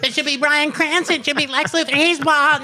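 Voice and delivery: very high-pitched, hammed-up squawk; mock fanboy outcry. Host mimics everybody demanding bald actors, mock fanboy outcry. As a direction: squeal it like a crowd of silly fans.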